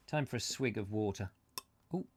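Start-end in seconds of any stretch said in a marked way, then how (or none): none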